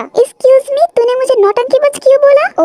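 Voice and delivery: offended retort. Acted. Girl offended by insult.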